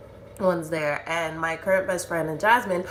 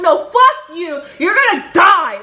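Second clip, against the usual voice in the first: high, loud screamed threat; furious re-enacted scream; psycho rage; 10 semitones higher, 10 dB louder than usual. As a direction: explode at him, drawing out the curse. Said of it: "I was psycho".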